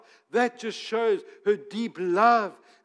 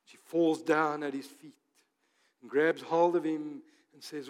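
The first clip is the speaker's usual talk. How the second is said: ordinary delivery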